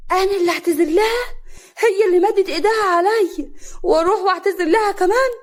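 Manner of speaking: high, loud protest; indignant. Acted. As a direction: refuse in outraged disbelief. Wife indignant at order to apologise.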